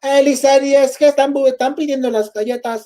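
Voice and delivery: put-on high-pitched copied Spanish accent; mom's borrowed dialect. Mother explaining the cookie request to son, high borrowed accent. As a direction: mimic Mom keeping up a singsong dialect. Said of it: "why are you talking like that".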